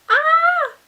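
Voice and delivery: high, loud delighted squeal; excited squeal. Real, not acted. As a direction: let out a thrilled little shriek. Squeals over unboxed item.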